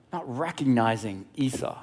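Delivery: ordinary talk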